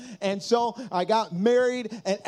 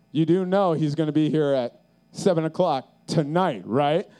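lightly voiced low guard voice; lower, questioning impression of a guard; doubtful security guard; 5 semitones lower than usual. Guard to early fan, lightly acted doubtful voice.

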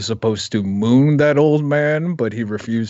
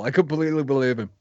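normal talking voice

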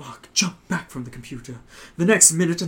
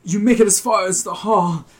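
usual speaking voice

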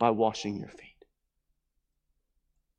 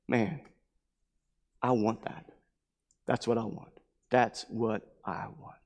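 soft, low-pitched murmur of admiration; hushed, understated awe; quiet longing; 4 semitones lower, 7 dB quieter than usual. Acted. Onlookers quietly longing for that love.

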